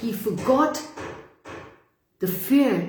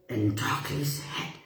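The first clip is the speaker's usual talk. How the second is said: whisper; 6 semitones lower, 7 dB quieter than usual. A narrator's reading. Whispered aside.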